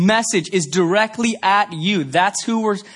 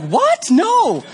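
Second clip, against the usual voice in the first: high, loud startled yelp; startled denial; 12 semitones higher, 4 dB louder than usual. Retold or imitated. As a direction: panic at being misunderstood. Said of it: "And I was like".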